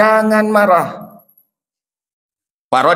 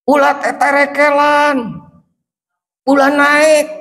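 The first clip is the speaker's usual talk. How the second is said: alarmed parent; 10 semitones higher, slower than usual. Retold or imitated. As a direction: call out in alarm. Parent calling to climbing child.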